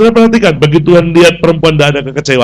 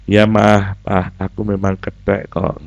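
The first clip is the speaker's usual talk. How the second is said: soft, slow and meek; gentle answer; 4 semitones lower, 5 dB quieter, slower than usual. Acted. Child answering scolding parents gently, soft. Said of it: "Jawab aja dengan lembut".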